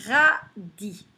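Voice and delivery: Radis in two separate beats, ra, di; split into syllables. Shown as a demonstration. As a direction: pause between the syllables of Radis. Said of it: "Deux syllabes".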